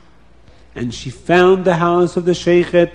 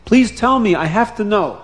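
higher, louder anxious begging; pleading; 6 semitones higher, 3 dB louder than usual. Acted. Bubby begging the crying sheikhet, higher and anxious. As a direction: beg him to tell her.

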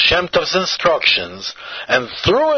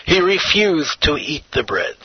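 plain reading voice

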